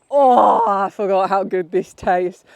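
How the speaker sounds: loud, bright burst of pleasure on the first sip; delighted. Retold or imitated. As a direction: savour it out loud. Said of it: "I drink the coffee and I'm like".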